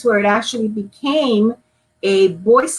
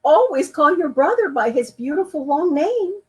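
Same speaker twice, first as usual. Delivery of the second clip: patient mother; 6 semitones higher than usual. Acted. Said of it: "said Mama".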